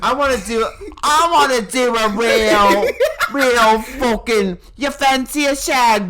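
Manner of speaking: put-on British accent. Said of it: "that's giving, like, Irish".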